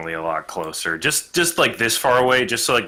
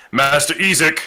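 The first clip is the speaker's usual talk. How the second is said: raised, higher call for attention; 6 semitones higher, 6 dB louder than usual. Acted. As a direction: catch the big man's ear before he leaves. Calling to draw his attention.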